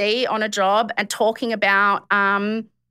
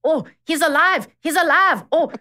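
mock South African rescuer, raised pitch; a shaky attempt at a South African accent; hammed-up South African accent, higher voice; 6 semitones higher than usual. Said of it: "I didn't do the accent ... South African".

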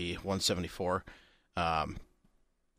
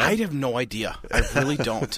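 laughing; 5 semitones higher, 6 dB louder than usual. Real, not acted. Laughing at his own hiccups.

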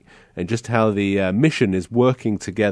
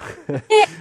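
high laughter; 5 dB louder than usual. Real, not acted. Host overcome during the story.

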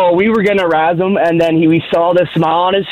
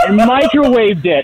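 laughing; 6 semitones higher than usual. Real, not acted. Laughing while telling story.

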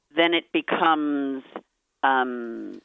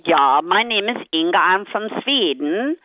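put-on Swedish accent; 3 semitones higher than usual. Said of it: "what about Swedish".

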